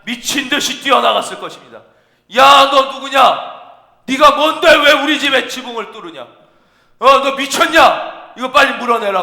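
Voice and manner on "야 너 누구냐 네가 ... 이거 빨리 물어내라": loud, higher-pitched outraged demand; angry homeowner. Acted. Homeowner to men breaking through his roof, angry shouting. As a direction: yell at whoever is wrecking your roof. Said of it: "막 그랬을 거 아니에요".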